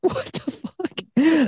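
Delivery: slow, drawn-out incredulous; stunned disbelief. Real, not acted. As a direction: stretch it out, baffled. Reacting to weapons joke.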